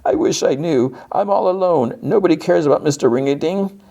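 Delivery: high, plaintive cartoon lament; hammed-up sorrowful cartoon voice; mock sad. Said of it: "he's really sad, sad eyes".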